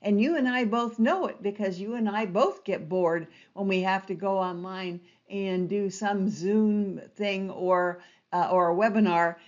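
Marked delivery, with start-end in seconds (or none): none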